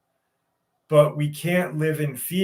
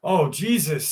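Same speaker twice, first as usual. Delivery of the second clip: usual speaking voice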